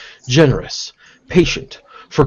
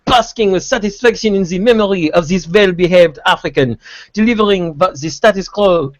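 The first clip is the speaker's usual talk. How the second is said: hammed-up fake French accent, pitched higher, laughing through it; obnoxiously bad fake French accent; 6 semitones higher than usual. Reading a badly translated article to friends in a fake French accent. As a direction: read it like a cartoon Frenchman, deliberately awful. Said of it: "Should I read this in an obnoxiously bad fra-, fake French accent?".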